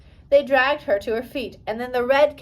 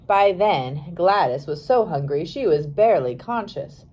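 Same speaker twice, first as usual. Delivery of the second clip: ordinary delivery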